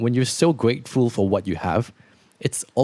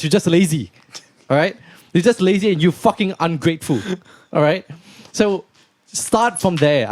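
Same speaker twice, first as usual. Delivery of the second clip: Andrew Tate manner; 5 semitones higher than usual. Pretend. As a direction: rant like a tough-love influencer who has no patience. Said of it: "let's do it in the Andrew Tate manner".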